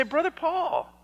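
high and loud, an astonished listener protesting; a church member who can't believe the story, calling out; incredulous objection. Acted. Congregation member objects in disbelief.

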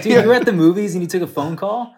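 high, loud disbelief; incredulous. Retold or imitated. Teasing friend about a call at the movies, high incredulous. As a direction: react like you can't believe it. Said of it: "I was like".

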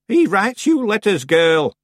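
lightly voiced old woman, slow and teasing; a hint of a sly old witch. A narrator's reading. Old Miss Treason teases Tiffany, lightly voiced old woman.

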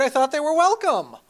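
mock surprise. Cohost feigns mock surprise.

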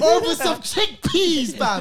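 high, very loud and stretched out; incredulous shout. Real, not acted. Storyteller astonished by slashed tires, high incredulous shout. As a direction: shout it slowly in disbelief.

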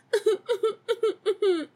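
high, whimpering mock sobs; attention-seeking boo-hoo; pathetic fake cry. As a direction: fake some pitiful little sobs. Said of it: "that, like, really pathetic fake cry".

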